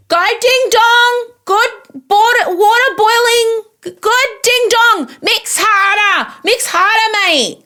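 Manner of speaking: shouting encouragement down to a contestant; hype woman cheering. Pretend. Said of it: "I could stand on the top and be like".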